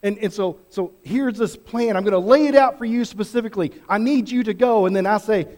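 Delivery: ordinary talk, nothing marked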